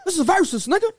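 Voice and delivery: hammed-up high-pitched yelling; mock screaming. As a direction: act out a screaming onstage spat. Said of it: "Start screaming".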